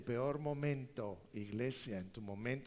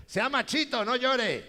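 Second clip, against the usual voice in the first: louder, higher scolding voice; mock stern elder; 11 semitones higher, 11 dB louder, slower than usual. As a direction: bark it like a macho uncle. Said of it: "Nos decían". (imitando a un tío regañón, más alto y fuerte)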